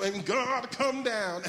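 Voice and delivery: drawn-out, high revival preacher chant; hooping prayer. Retold or imitated. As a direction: imitate a whooping church prayer. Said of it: "we had hooped when we prayed".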